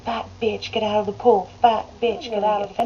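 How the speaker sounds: ordinary delivery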